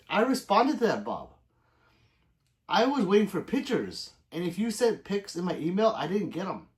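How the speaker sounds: normal talking voice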